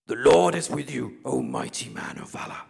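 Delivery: booming angel echo, dropping to a deep breathy rumble; a mock voice from heaven. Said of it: "automatic echo in his voice".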